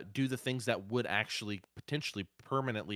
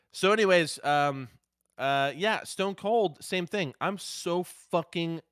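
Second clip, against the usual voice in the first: hyped up; 7 semitones higher, 6 dB louder, slower than usual. Real, not acted. Hyped return to story.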